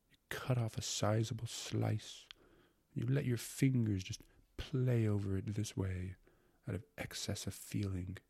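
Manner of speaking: hushed, slow, sensuous gourmand; carried-away secretary. Acted. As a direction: savor each word as if tasting it. Secretary carried away describing meat pie.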